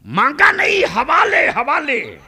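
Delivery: very high cry, easing at the end; full-force preaching. Real, not acted. Preacher stresses entrustment to the congregation, full-force preaching. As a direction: call the key word out high. Preacher cries out the key word.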